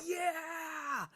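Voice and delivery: high drawn-out cheer; freaking out. Acted. Alivire freaking out over the fight, excited cheer. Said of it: "Alivire's freaking out".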